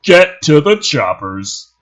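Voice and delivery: loud, slow barked order; drawn-out commanding yell. A narrator's reading. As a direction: shout it to the troops. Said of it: "He yells".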